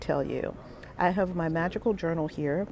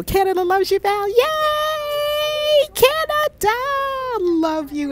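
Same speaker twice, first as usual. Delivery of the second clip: much higher, louder shrieked yay; shrieking cheer; 5 dB louder, slower than usual. Real, not acted. Host reading a Canadian fan's comment, shrieking cheer.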